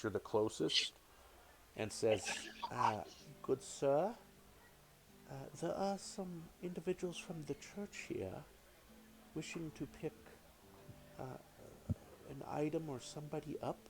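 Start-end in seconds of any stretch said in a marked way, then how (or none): 2.7-13.8 a softer, hesitant servant voice; timid serving girl addressing a lord; lightly voiced deferential servant; polite servant. GM voices the wait person.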